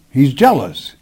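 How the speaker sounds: normal talking voice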